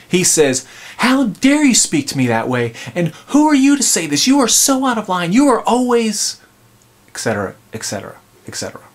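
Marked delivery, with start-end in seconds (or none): 1.0-6.4 acted tirade climbing to a high pitch; high-pitched, self-righteous scolding; indignant outburst. Acting husband's self-righteous attack. 7.1-8.8 quick, low, softer aside; rattled off, fading away; tossed-off trailing list. Real, not acted. Dropping out of acted tirade.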